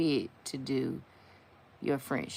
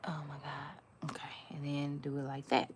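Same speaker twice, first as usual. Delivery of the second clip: hushed, fast aside under her breath; whisper; 2 semitones lower, 9 dB quieter than usual. Real, not acted. Nail artist whispering to herself mid-stroke. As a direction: breathe it out while the brush moves.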